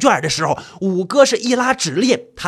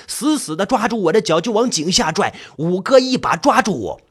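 ordinary delivery, nothing marked

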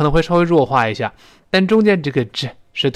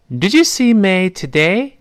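slow clear question with rising end; careful teacher read, rising tune; slow model reading; 5 semitones higher than usual. Shown as a demonstration. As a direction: read it slowly, lifting at the end. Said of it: "她说".

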